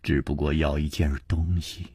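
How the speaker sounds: low, soft, level delivery; subdued woman of few words. A narrator's reading. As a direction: state the terms quietly, giving nothing away. Narrator voices Liu Wumei.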